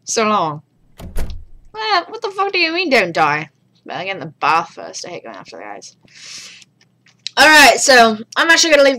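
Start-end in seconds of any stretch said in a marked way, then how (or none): 1.7-3.5 indignant. Pretend. Mock indignant outburst.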